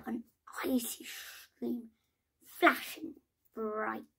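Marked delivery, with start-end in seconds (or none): none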